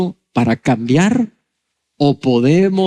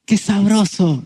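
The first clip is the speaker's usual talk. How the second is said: put-on high, blissful patient; high-pitched mock delight; mock contentment; 5 semitones higher than usual. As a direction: pretend to relish the treatment. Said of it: "No, está pegando gritos".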